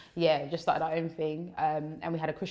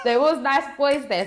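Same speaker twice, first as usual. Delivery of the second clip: laughing; 9 semitones higher, 7 dB louder than usual. Real, not acted. Repeating confession laughing.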